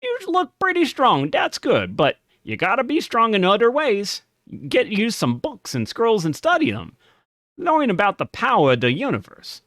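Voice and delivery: high, odd little goblin shopkeeper; a second go at the weird little dude. Acted. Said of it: "let me see".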